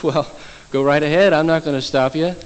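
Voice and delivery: ordinary talk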